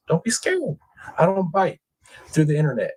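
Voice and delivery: mock-sweet high coaxing; high, playful reassurance. Teasing viewers to join.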